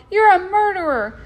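high, loud cry of grief; sobbing accusation. A narrator's reading. Boy turns on the hunter who shot his pet, sobbing. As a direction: hurl the accusation through sobs. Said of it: "he sobbed".

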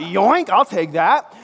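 hammed-up playful theft; cheeky grab. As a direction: Peter snatching a boy's meal, gleefully cheeky. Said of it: "Peter's like".

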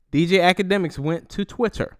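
ordinary talk, nothing marked

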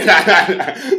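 high, loud laugh; loud burst of laughter. Real, not acted. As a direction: break into a big laugh. Laughs at own joke.

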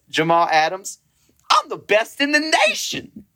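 high, squeaky boast of the name then the line; Jamal Adams impression. Podcaster imitating Jamal Adams' intro for his cohost, squeaky high boast. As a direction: pitch way up for a cocky player intro. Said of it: "Literally just like that".

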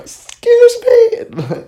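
very high, slow, strained squeak; squeaky excuse me. Retold or imitated. Asking the creepy old lady to move, in a tiny squeak. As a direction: squeak it out timidly, stretched thin.

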